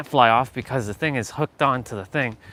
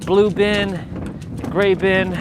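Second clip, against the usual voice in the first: raised slow voice; calling out; 5 semitones higher, 5 dB louder, much slower than usual. Real, not acted. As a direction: call it out loud. Shouting over cold wind.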